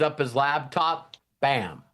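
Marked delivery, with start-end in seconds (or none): none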